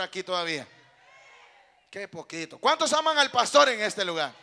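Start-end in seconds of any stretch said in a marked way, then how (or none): 2.6-4.3 much louder, challenging call to the room; rousing shout. Real, not acted. Preacher asks congregation who loves the pastor, rousing shout. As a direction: shout it out for a bigger response. Asks again after a weak response.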